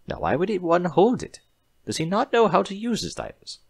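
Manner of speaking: high, curious voice; puzzled doctor. A narrator's reading. Doctor pony asking the guard, high and puzzled. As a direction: wonder aloud like a fussy scientist.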